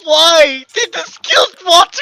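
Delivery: high, crying shout of disbelief; anguished shout. Real, not acted. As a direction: wail it out as the favourite dies.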